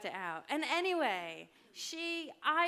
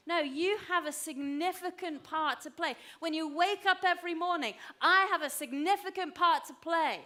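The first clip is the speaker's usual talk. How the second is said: loud, higher preaching exhortation; emphatic declaration; 5 semitones higher, 6 dB louder than usual. Real, not acted. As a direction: declare it boldly to the congregation. Preacher urging the congregation.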